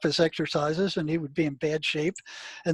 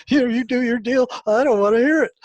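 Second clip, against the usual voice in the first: wife dismissing; 6 semitones higher, 6 dB louder than usual. Retold or imitated. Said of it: "she goes".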